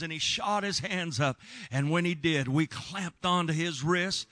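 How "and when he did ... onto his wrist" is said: ordinary talk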